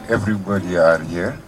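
ordinary talk

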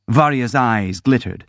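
usual speaking voice

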